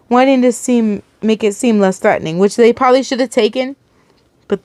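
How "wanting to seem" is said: usual speaking voice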